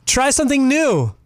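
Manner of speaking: ordinary talk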